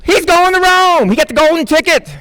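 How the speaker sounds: imitated game-show triumph; talent-show celebration. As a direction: announce it like a winning contestant's big moment. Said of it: "like, America's Got Talent".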